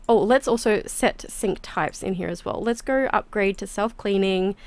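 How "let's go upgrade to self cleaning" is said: usual speaking voice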